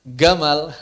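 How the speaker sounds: Gamal said with a hard g, not j. Shown as a demonstration. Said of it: "nggak ada Jamal, adanya".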